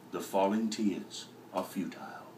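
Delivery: breathy whispered final line. Acted. Ending the epic reading to viewers in a whisper. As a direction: let the last line fall to a whisper.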